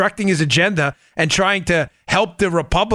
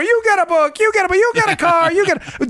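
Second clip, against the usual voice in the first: excited high-pitched giveaway host; Oprah; 12 semitones higher than usual. Acted. Host recalls talk-show giveaway, excited announcing voice. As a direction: hand out prizes in high, rapid exclamations. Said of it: "You know, remember Oprah?".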